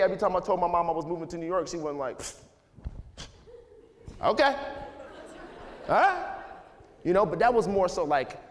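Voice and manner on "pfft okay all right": reluctant mom. Retold or imitated. Mom reluctantly agreeing, retold.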